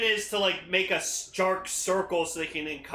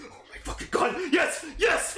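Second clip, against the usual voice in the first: hyped shout; 8 semitones higher than usual. Retold or imitated. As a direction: voice jumping much higher into a yell. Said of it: "I've never seen him so hyped before".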